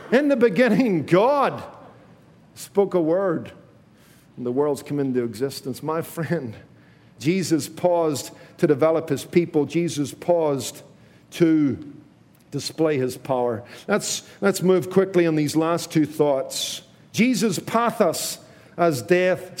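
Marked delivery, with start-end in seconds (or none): none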